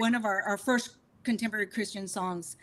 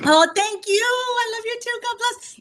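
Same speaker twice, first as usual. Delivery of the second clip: high, sweet, affectionate gush; real touched delight, pitched way up; gushing thanks; 11 semitones higher, 5 dB louder than usual. Real, not acted. Singer thanking a friend who sent love, sweetly gushing.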